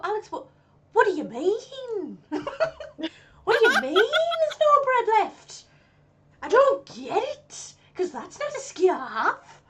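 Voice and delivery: high, very slow, baffled Mackem drawl; exaggerated Sunderland voice. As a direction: stretch it out, puzzled north-east. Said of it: "I don't go".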